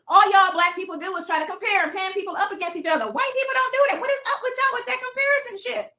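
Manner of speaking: loud, high-pitched exasperated scolding; exasperated rant. Real, not acted. Scolding viewers over constant comparisons, exasperated rant.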